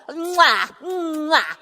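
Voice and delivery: two smacking kisses; kiss sounds. Acted. Wife kissing Finn goodbye, smacking kisses.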